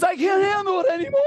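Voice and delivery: high, loud, cracking wail of a man giving up; panicked cry. Retold or imitated. Quitter bailing out of the ice bath, high panicked cry. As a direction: whine it like you're breaking in the freezing water. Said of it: "a guy jumps out and goes".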